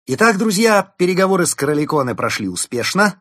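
loud, high proclamation; triumphant announcement. A narrator's reading. Fox arriving with news for friends, announcing triumphantly. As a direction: proclaim good news to everyone. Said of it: "с порога возвестил".

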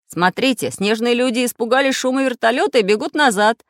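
delighted. A narrator's reading. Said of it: "обрадовался Самоделкин". (обрадованно)

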